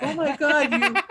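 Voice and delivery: high-pitched mock alarm; imitated girlfriend. Girlfriend left behind by fleeing boyfriend, high mock alarm. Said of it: "and the girlfriend's like".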